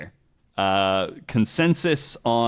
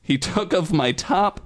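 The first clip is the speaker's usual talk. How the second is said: mock-earnest fanfic reading, a bit higher; dramatic reading; 4 semitones higher than usual. Host reading bad fanfic to cohosts, mock-dramatic read. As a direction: read the trashy prose as if it were literature.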